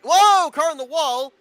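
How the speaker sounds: sudden high, loud crash call; alarmed shout. Real, not acted. Race commentator spots a crash live, alarmed shout. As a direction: cry out the wreck as it happens, slow and stretched.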